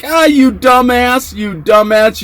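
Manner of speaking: high, drawn-out taunt; high-pitched retold ridicule; mock jeering. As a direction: imitate a cellmate gloating. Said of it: "sitting there laughing at him like".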